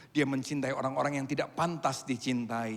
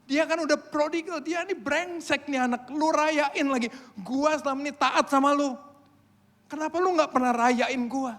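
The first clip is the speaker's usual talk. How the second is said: high, loud indignant complaint; resentful older brother; 13 semitones higher, 7 dB louder than usual. Retold or imitated. Older brother protesting to his father about the party, loud and bitter. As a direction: whine and protest at the unfair party. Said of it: "abangnya lihat".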